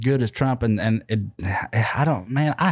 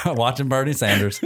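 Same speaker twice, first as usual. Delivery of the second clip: ordinary talk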